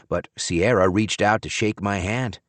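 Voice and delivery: ordinary delivery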